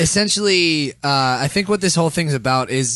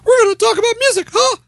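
very high squeaky cartoon mouse; Mickey Mouse; 7 dB louder than usual. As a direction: squeak it out falsetto. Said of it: "Mickey never really says it".

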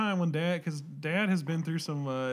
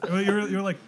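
normal talking voice

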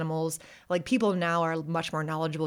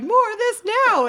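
put-on high, loud bossy child; small child insisting; demanding little kid; 9 dB louder than usual. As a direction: demand it like a toddler. Five-year-olds voiced demanding.

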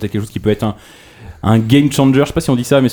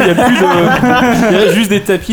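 laughing; 4 semitones higher than usual. Real, not acted. Blague absurde sur les amiibo.

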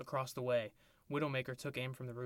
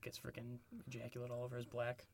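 a hushed, soft aside; whisper; 9 dB quieter than usual. Real, not acted. Host adding a lewd detail, a soft hushed aside. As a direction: drop to a whisper for the gross bit.

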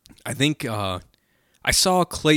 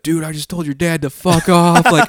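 higher-pitched imitated fluster; mock panicked confession; 6 semitones higher, 5 dB louder than usual. Friend confessing to son he cursed dad, mock panic. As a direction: own up in a hammed-up panic.

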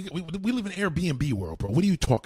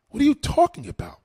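outraged shout; 10 semitones higher, 6 dB louder than usual. Real, not acted. Outraged repeat.

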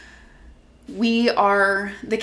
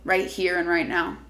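guided meditation; 5 dB quieter than usual. Acted. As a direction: gently guide them inward.

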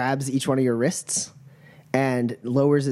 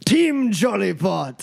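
high, slow, drawn-out announcement; fight announcer; 8 semitones higher than usual. Acted. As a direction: proclaim the team name with pomp. Announcer repeats the player's team name.

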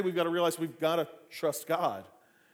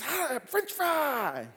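put-on a child's drawn-out shout, much higher and slow with a laugh; kid's slow cry; 7 semitones higher, much slower than usual. Boy copies his praying dad, slow high kid cry. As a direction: stretch it like a kid mimicking hallelujah.

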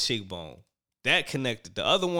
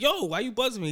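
high-pitched mock protest; retold protest; 13 semitones higher, faster than usual. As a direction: quote his objection, pitched way up. Retold protest at being buzzed.

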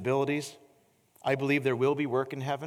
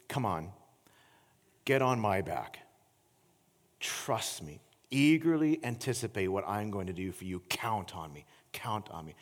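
softer, lower tender call; gentle invitation; 5 semitones lower, 3 dB quieter than usual. Acted. The Lord inviting the weary wanderer to trust him, tender. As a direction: invite them in, soft and low.

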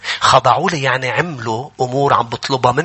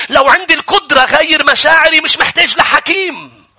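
high, forceful sermon delivery; impassioned preaching; 10 semitones higher, 5 dB louder than usual. Acted. Preacher urging the congregation to submit to God, impassioned. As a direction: drive each phrase home at a raised pitch.

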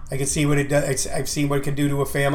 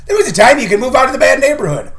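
fast, higher, laughing it out; 8 semitones higher, 5 dB louder, faster than usual. Real, not acted. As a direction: laugh through the line. Laughing at own line.

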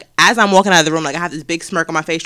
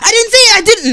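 put-on high, loud protesting child; loud, high mock kid denial; defensive kid; 13 semitones higher, 6 dB louder than usual. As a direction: whine the denial like a caught fifth grader. Kid denying in hallway.

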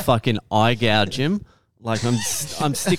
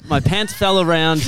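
laughing; 5 semitones higher than usual. Real, not acted. Laughing at his pants falling.

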